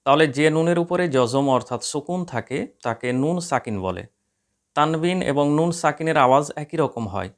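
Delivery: ordinary delivery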